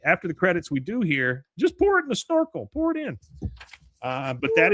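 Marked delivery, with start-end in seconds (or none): 1.6-3.2 high, loud hammed-up quote; acted outburst. Quoting the clip after the credits, high and loud. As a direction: call it out way up high.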